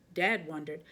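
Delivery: usual speaking voice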